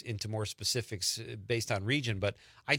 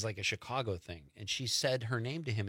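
normal talking voice

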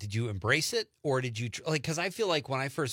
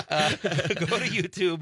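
laughing through the words, slowly; 5 semitones higher, 6 dB louder, slower than usual. Real, not acted. Laughing at his old web habit.

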